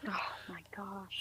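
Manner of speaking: breathless, near-silent gasp of dismay; shocked whisper. Real, not acted. Guest reacting to host's stroke story, whispered shock. Hearing of his two strokes.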